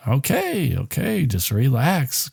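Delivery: mock soothing brush-off. [name] brushing off the mother, softly dismissive. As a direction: wave her off quietly and smugly.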